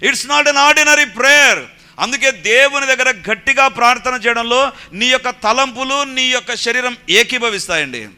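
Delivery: high, loud, impassioned sermon delivery; full-force preaching. Real, not acted. Preacher urging believers to pray aloud from the heart, full force. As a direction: drive each point home to the congregation, loud.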